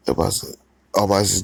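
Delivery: ordinary delivery, nothing marked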